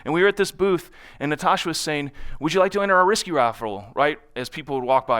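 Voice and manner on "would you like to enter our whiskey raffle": fast, higher, louder sales patter; rapid-fire pitch. Retold or imitated. Natasha pitching the raffle at the booth, fast. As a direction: rattle it off at passersby. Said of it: "she can talk really, really fast".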